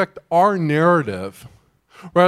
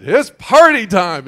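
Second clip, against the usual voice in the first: much louder, slow, mock whoop; mock party cheer; 4 semitones higher, 11 dB louder, slower than usual. Pastor mimics news channels cheering once rules lifted, loud mock whoop. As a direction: shout it like the media throwing a party. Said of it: "and they said".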